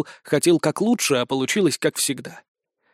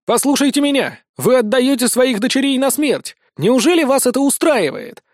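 high, raised pleading to a crowd; urgent appeal; 8 semitones higher, 3 dB louder than usual. A narrator's reading. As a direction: make them listen to reason. Hero pleads with the angry villagers. (горячо взывая к толпе)

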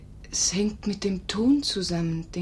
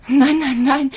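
higher, much louder cries of no; eager no; 6 semitones higher, 11 dB louder, slower than usual. Acted. Nurse loudly protesting before accepting proposal. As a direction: burst out protesting.

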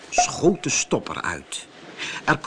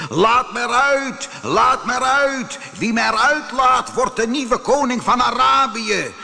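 calling out; 10 semitones higher, 4 dB louder than usual. Acted. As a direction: plead loudly to be let out. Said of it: "riep ik steeds". (luid en smekend roepen)

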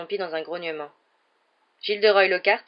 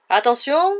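much louder and higher; shouted command; 8 semitones higher, 9 dB louder, slower than usual. Acted. Lockhart ordering the duellists, shouting. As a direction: bark it across the hall. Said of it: "cria Lockhart".

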